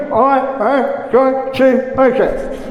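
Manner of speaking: slow, strained self-willed vow; through gritted teeth. Retold or imitated. Preacher mocking a believer who forces it by willpower, teeth gritted. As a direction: grit your teeth and force the words out. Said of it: "not on you gritting your teeth".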